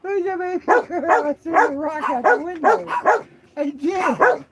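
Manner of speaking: put-on high, loud baby talk to a pet. Owner teasing the dog in high baby talk.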